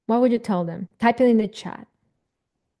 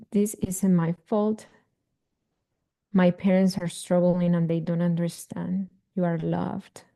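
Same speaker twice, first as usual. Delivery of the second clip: guided meditation. Acted. Coach guiding parents through an inner child meditation, soothing. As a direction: talk them gently into the memory.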